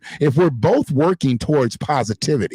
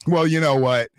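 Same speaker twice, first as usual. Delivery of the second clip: ordinary talk, nothing marked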